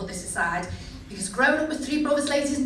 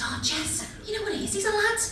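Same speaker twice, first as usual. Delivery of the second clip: put-on girlfriends' voice; 8 semitones higher than usual. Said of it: "They turned to me and said".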